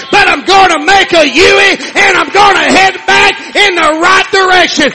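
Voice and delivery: loud, ringing sermon delivery, sung out; full-force preaching. Acted. Pastor preaching at full force to the congregation. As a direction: preach it hard to the whole church.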